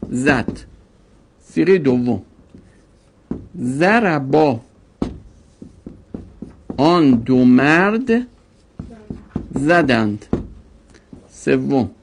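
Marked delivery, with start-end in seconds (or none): 3.6-4.6 ضربا said very slowly, syllable by syllable; slow demo form. Shown as a demonstration. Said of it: "slow demo conjugation". 6.8-10.3 slow translation. Shown as a demonstration. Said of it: "slow translation demo".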